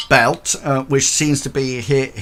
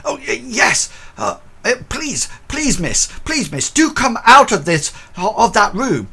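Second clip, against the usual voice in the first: much higher, fretful pleading guard voice; panicky old porter; 11 semitones higher, 4 dB louder than usual. Acted. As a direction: beg her out of the room in a fluster. Porter Bert urging miss out.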